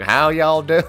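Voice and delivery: loud, high mock Southern twang; Southern accent. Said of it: "it's not like a Southern accent".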